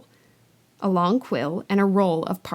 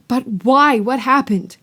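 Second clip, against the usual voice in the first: urgent question; 4 semitones higher, 6 dB louder than usual. Acted. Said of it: "Harry asked urgently".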